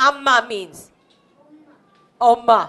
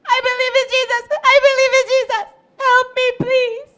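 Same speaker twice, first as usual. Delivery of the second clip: high, urgent begging; frantic pleading; 11 semitones higher, faster than usual. Acted. Runaway girl begs Amy for shelter, frantic pleading.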